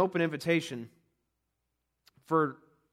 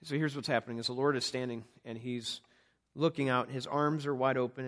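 ordinary delivery

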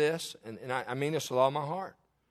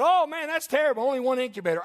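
higher, hammed-up dismay at his trouble; mock sympathy; 7 semitones higher, 4 dB louder, faster than usual. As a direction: play along with fake sorrow. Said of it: "I said".

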